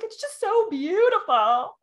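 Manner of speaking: high, loud, slow tearful awe; imitating herself weepy over beauty; mock teary gush. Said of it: "I'm like".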